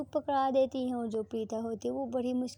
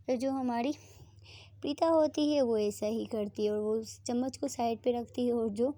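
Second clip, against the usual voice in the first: plain reading voice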